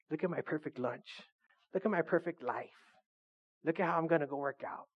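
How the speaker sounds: soft, hushed mock vanity; mock showing off. Someone posting on Instagram, hushed mock showing off. Mimics showing off on social media.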